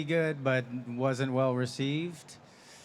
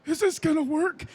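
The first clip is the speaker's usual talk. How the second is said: a strained hoarse thank-you, much higher; 5 dB louder than usual. Retold or imitated. Thanking the crew after four hours of screaming, hoarse voice. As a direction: croak it out with a burnt-out throat.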